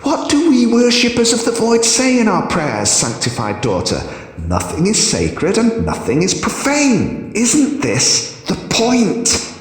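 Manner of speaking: high, mocking Hierophant. A narrator's reading. Hierophant mocks her, high voice.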